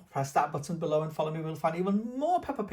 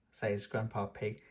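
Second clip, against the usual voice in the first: plain reading voice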